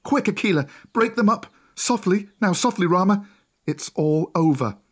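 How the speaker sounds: high urgent shouted orders; urgent cry. A narrator's reading. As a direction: call out to calm the stampede. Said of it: "Mowgli cried".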